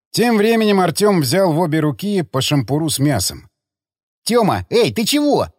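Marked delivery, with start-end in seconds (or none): 4.2-5.5 high, outraged protest; indignant. A narrator's reading. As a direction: push back, raised and high. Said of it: "возмутился Павел".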